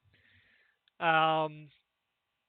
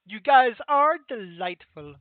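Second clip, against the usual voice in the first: slow, loud, higher-pitched delight; gushing character; 4 semitones higher, 12 dB louder, slower than usual. Acted. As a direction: gush it slowly and loudly. Game master voices pleased character.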